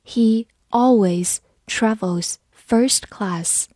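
slow, careful reading for learners. Shown as a demonstration. Teacher reads slowly for the class. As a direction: read it slowly, word by word. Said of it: "好，慢读一遍。".

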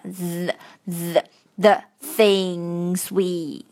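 "the things we" said very slowly with the tongue bitten; tongue-between-teeth th. Shown as a demonstration. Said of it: "都是要咬舌头的".

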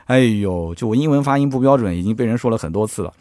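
ordinary delivery

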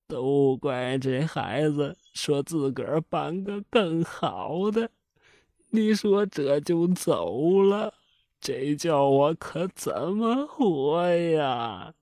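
slow, drawn-out weeping complaint; tearful lament. A narrator's reading. Old mother waking from a faint, slow tearful lament. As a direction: mourn the son through tears. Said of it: "老太太一看儿子死了，也哭昏过去了".

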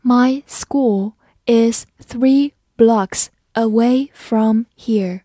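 slow, careful sentence for learners; slow read. Shown as a demonstration. Teacher reading slowly so students can follow. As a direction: read it slowly, every word clear. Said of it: "好，我们慢慢来读".

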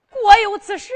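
high, loud startled question; shocked question. Acted. Old mother hearing her son-in-law faces execution, shocked. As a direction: jolt up in disbelief.

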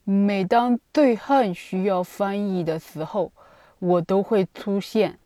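slow, thick-tongued lisp; mushy lisping speech, slowed down. Retold or imitated. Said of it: "吐槽自己的大舌头说".

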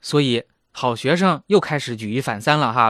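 ordinary talk, nothing marked

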